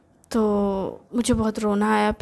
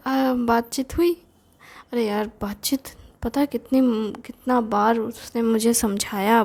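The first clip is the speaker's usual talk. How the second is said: usual speaking voice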